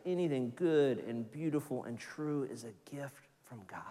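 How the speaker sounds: hushed, breathy, no voice; whisper. Real, not acted. Pastor whispering to the congregation that all good is God's gift. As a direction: lean in and let it land softly.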